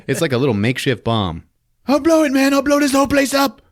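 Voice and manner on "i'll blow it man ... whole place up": high, loud ranting thug voice; mock villain yell. Gunman yelling at hostages, high mock shout. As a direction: screech it like a crazed gunman.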